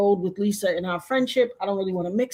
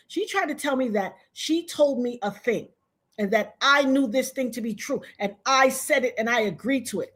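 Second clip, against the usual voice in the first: usual speaking voice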